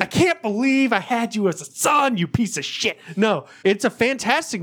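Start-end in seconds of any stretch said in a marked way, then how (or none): 0.0-3.0 higher-pitched, hammed-up fatherly outrage; mock disgusted dad. Imitating his dad disowning him, mock outrage. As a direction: scold your son in mock disgust.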